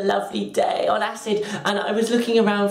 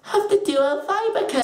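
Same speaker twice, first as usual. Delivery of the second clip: high, slow sales pitch; hammed-up high-pitched shop assistant; imitated saleswoman; 13 semitones higher, slower than usual. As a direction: draw out the recommendation, mocking her.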